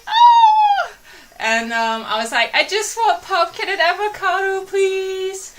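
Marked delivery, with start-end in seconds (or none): 0.1-0.9 very high, loud yelped oh; shocked oh. Retold or imitated. Caught off guard by the server, a high loud yelp. Her shocked reaction at counter. 2.5-5.5 higher, timid little request; meek high plea. Retold or imitated. Asking the server politely for pumpkin and avocado, high and meek. Ordering meekly in retold scene.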